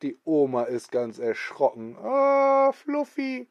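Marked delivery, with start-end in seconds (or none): 2.0-3.5 put-on much higher, thin old lady call; old woman calling. Grandma calling her runaway dog to stop, high old voice.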